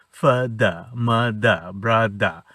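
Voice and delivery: "ফাদা, মাদা, ব্রাদা" with no final r; British silent R. Shown as a demonstration. Teacher drills words for a child, final r dropped. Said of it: "এরকম করে সে ব্যবহারটাও শিখলো".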